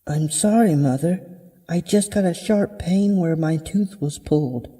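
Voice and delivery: put-on high, meek girl's apology; apologetic daughter. Daughter explains the broken plate to mother, high apologetic voice.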